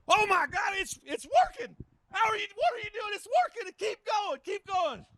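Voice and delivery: very high, thin, breathless cries of keep going; shrill excited farmer. Acted. Farmer urging the vet on, in a very high excited squeal.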